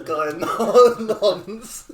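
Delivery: high, loud, breaking up with laughter; laughing. Real, not acted. Friend retelling his gaming insult, laughing. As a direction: laugh helplessly through the words.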